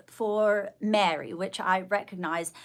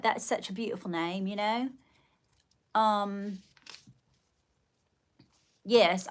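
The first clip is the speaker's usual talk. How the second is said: ordinary talk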